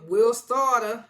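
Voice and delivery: "starter" belted out, ending in an open "ah"; loud, high "starter" with the final r dropped; catchphrase imitation. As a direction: shout "starter" like a goofy boast, as "stah-tah". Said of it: "he kept saying".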